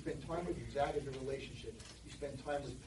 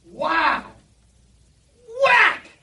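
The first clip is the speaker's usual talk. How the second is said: high, loud gleeful shout; excited yell; 21 dB louder than usual. Retold or imitated. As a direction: swing and yell it with glee. Kid swings bat at father.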